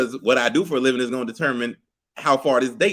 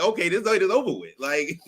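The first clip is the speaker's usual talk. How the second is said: usual speaking voice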